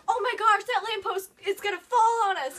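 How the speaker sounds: panicked. Retold or imitated. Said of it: "and we're like".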